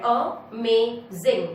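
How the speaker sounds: amazing very slow, a... may... zing; three well-separated beats in amazing; syllable by syllable. Shown as a demonstration. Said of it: "तीन sounds निकल रहे हैं".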